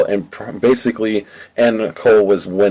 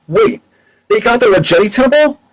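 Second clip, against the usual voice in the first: much higher, alarmed squeak; shocked question; 14 semitones higher, 3 dB louder than usual. Acted. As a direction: catch the bad news in alarm. Shocked news about the temple.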